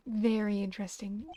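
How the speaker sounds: ordinary delivery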